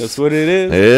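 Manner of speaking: loud, slow mimicked English accent; imitated anglophone accent. Host mimicking the guest's anglophone accent, loud and drawn out.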